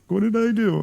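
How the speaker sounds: high-pitched voice for an innocent dog; squeaky-high mock bewilderment; worried dog voice. Voicing his freaked-out dog.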